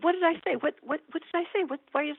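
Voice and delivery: high, stammered questions; retold flustered questioning; acted bewilderment. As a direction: play a panicked, puzzled nurse. Acts baffled nurse questioning patient.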